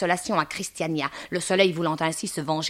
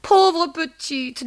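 high, slow, drawn-out pity; pitying landlady; 9 semitones higher, 6 dB louder, much slower than usual. Acted. Madame Dehan pitying her pregnant lodger, high lament. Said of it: "dit madame Dehan".

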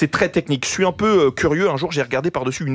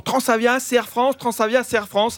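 mimicking a sales pitch; 5 semitones higher than usual. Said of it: "on me dit toujours".

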